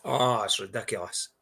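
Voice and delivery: usual speaking voice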